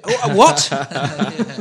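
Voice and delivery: laughing. Real, not acted. Caught out, bursting into laughter.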